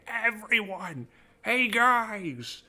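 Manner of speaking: high, slow squawking parrot voice running through the streets; Gilbert Gottfried. Retold or imitated. Voice actor blurting spoilers through the Japanese streets, high and slow. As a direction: shriek the spoilers out like a loudmouth parrot. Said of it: "my best Gilbert Gottfried impression".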